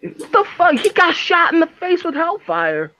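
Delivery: high, indignant what-the-fuck; incredulous outcry; shocked disbelief. Real, not acted.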